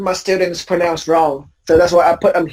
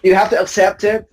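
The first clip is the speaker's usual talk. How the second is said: normal talking voice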